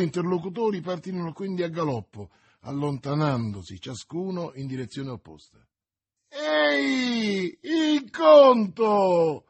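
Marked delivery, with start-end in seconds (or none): 6.3-9.5 high, long-drawn shout; bawling. A narrator's reading. Innkeeper calls after the guest leaving without paying, bawling. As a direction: holler after a departing customer, stretching the words. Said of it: "sbraitò l'oste".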